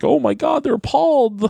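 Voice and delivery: mock appalled insider. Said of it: "I'm sure they're like".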